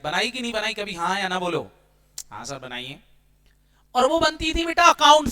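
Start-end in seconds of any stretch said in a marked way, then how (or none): 2.3-3.0 a whispered, timid student reply; mock student whisper. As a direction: whisper it like a shy pupil answering sir. Teacher voices student's answer. (फुसफुसाते हुए छात्र की नकल)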